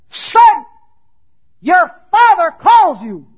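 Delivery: very high, loud proclamation; mighty angel's call. Acted. Preacher voicing the angel calling Jesus from the grave, loud shout.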